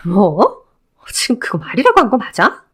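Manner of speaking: lightly voiced ex-wife, cold and scornful; a hint of a scornful woman cutting him down. Ex-wife rebuffing her ex-husband, lightly voiced cold scorn.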